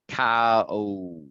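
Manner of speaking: cow said the way the student does it; imitating student's cow. Teacher mirroring a student's cow pronunciation. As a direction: mimic what you hear. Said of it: "It sounds like".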